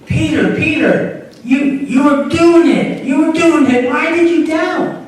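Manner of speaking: a hint of Jesus coaxing a friend; lightly voiced Jesus calling Peter back; Jesus encouraging Peter.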